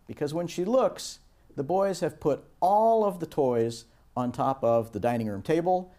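ordinary talk

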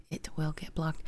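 whispered aside; quick breathy whisper to himself. Real, not acted.

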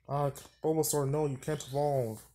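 ordinary delivery

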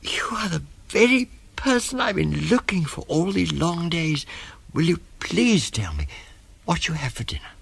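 high-pitched, panting excitement; breathless elephant child. Acted. Elephant child asks crocodile about dinner, breathless and high. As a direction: gasp it out high, pausing for breath. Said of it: "grew all breathless and panted".

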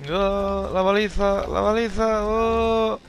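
high panicked cry; startled high yelp. Real, not acted. Beacon swallowed by monster.

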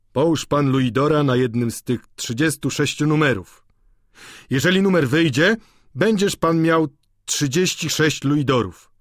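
a hint of a dignified old gambler advising a newcomer; old man voice, only lightly done. A narrator's reading. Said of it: "szanowny jakiś starzec o białych włosach".

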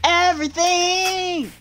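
sappy. Pretend. Mock love declaration to Isaiah.

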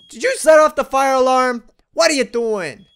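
loud, high alarmed shout. Real, not acted. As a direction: shout over the fire alarm. Fire alarm goes off.

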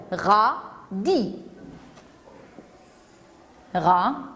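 "radis" as ra... di; syllable by syllable. Shown as a demonstration. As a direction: count out "radis" in two spaced beats. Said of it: "Deux syllabes".

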